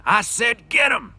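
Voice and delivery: loud, high, slow yell; slow furious yell. Acted. George orders Lenny to fight, slow furious yell. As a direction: drag every word out at full volume. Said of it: "George yelled again".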